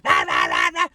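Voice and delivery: very low, growly barks; snarling imitation of a ratty little dog; mock demon dog growl. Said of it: "demon dog that's like".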